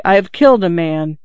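usual speaking voice

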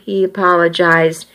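plain reading voice

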